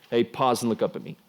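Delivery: ordinary talk, nothing marked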